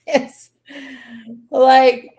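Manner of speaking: ordinary talk, nothing marked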